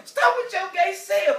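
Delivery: hammed-up high wife voice; wife's retort. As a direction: drag it out like a wife mocking her husband. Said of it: "She told me".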